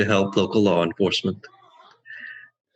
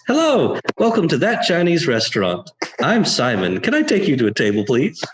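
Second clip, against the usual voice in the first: much higher, beaming host greeting; big bright smile in the voice; charming welcome; 5 semitones higher than usual. Acted. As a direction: turn on all the charm for new guests. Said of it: "I smile at them big and broad, my best charm".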